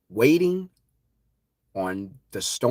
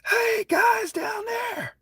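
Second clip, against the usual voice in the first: high, slow, stretched calling shout; screaming a warning; much slower than usual. Retold or imitated. Warning people on the beach of the tsunami, screaming. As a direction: holler down to the beach. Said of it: "I'm screaming".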